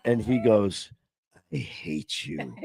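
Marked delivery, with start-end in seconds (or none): 1.5-2.6 exhausted groan. Retold or imitated. Exhausted client after arm workout.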